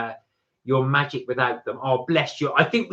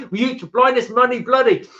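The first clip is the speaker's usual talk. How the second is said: mock British accent; 9 semitones higher, 7 dB louder than usual. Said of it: "He was trying to do a British accent there".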